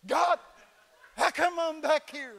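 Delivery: high, loud mock complaint to God; mad at God. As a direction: whine up at heaven, hammed-up. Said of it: "And I'm mad".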